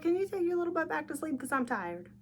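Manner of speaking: high, fast, fed-up plea; a tired mom begging the kid back to bed; exasperated parent. Retold or imitated. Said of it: "I was like".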